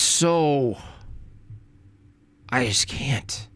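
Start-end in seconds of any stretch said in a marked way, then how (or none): none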